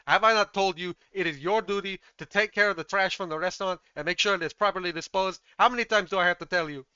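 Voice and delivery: furious head-chef dressing-down; Gordon Ramsay. Acted. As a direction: berate your underling, speeding up at the end. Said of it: "Gordon Ramsay, like, hands on head".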